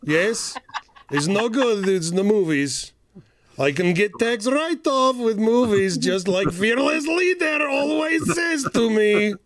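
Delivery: lightly hammed Boris-style villain accent; villain Russian accent. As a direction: play a cartoon Russian villain, slow. Zaslav as cartoon villain.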